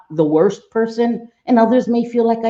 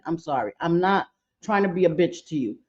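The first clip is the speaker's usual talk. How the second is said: ordinary delivery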